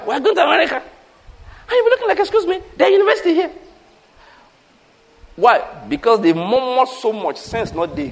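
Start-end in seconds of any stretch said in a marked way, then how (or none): 0.1-3.5 high, laughing incredulity; laughing mock disbelief. Preacher mocking parents who pull kids from university, laughing. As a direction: laugh at the absurdity while quoting.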